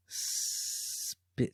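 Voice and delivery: drawn-out, hushed whisper; whispered segment intro. Acted. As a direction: whisper the segment title for suspense.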